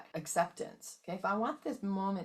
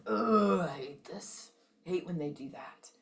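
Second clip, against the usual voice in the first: lower-frequency grumble; 3 dB louder than usual. Shown as a demonstration. Contrasting hate with love for the class, sinking mock grumble. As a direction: let it drop into a gripe. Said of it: "is a higher frequency than".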